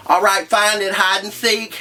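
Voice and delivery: cheeky taunt. Retold or imitated. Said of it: "and I'm like".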